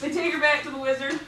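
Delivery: fast, steadier retake rising at the end; quick retry. Real, not acted. Teller retries the line to listeners, hurried and steadier. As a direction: push through it quickly this time.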